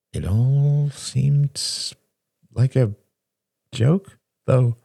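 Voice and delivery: slow and drawn out, each word weighed; slow, hesitant reflection; slowly. A narrator's reading. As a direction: let the words come slowly, unsure. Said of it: "said Shane slowly".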